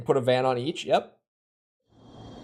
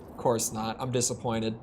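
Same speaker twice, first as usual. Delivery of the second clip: ordinary delivery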